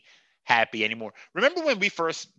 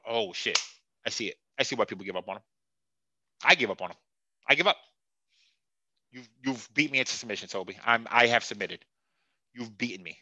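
soft, lower resigned surrender; defeated; 5 semitones lower, 4 dB quieter than usual. Real, not acted. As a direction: give up, worn out and beaten.

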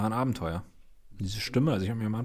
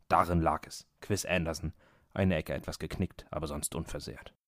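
story narration; 4 semitones lower than usual. A narrator's reading. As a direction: read it like a radio drama narrator. Erzählt Hörspielgeschichte weiter. (wie ein Hörspielerzähler vorgelesen)

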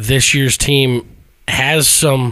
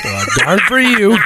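slow, higher, drawn-out mock pity; mock sympathy; 7 semitones higher, slower than usual. Teasing co-host over "darn", slow high mock pity. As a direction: drag it out like fake sympathy for a buddy. Mocking co-host's "darn" remark.

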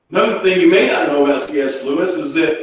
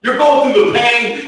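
ordinary delivery

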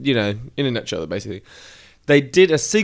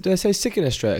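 imitating kids; 6 semitones higher, 3 dB quieter, much faster than usual. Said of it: "They said".

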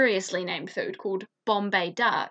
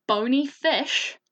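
normal talking voice

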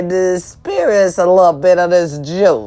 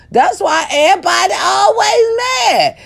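high shout; 13 semitones higher than usual. Shown as a demonstration. To viewers, shrieking why everybody is mad. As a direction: belt out always mad in a piercing squeal.

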